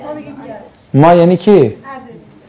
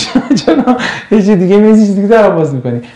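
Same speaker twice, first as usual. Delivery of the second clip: fast, higher, laughing through the question; laughing protest; 5 semitones higher, faster than usual. Real, not acted. Teacher teasing Azeris about their pronunciation, laughing protest. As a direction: tease them while breaking into laughter.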